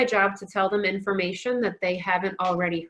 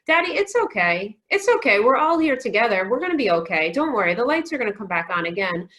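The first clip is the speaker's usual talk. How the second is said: put-on reassuring three-year-old; 4 dB louder than usual. Three-year-old son comforting his dad, childlike reassurance. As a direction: comfort Daddy like an earnest small child. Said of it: "three-year-old who was trying to reassure his dad".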